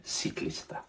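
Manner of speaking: "ciclista" with S for C, no TH; Peruvian Spanish pronunciation. Shown as a demonstration. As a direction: say "ciclista" as see-KLEES-ta. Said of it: "my Spanish is from Peru".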